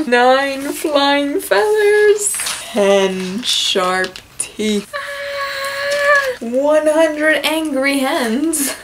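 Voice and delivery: slow, drawn-out storybook reading with big voices; dramatic read-aloud. Acted. Librarian reading a counting book to children, dramatically. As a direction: savor each number like story time.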